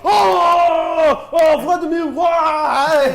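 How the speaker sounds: loud, high wail; anguished cry. Acted. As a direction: cry out in pain. Gangsters cry out after pepper spray.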